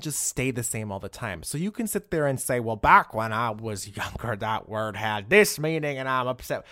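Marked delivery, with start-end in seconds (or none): 2.6-6.6 slow, drawn-out mock complaint; mock grumbler. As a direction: drag it out loudly as a caricatured stick-in-the-mud. Mocks people clinging to old language.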